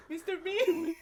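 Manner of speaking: put-on girl voice. Girl voice, laughing.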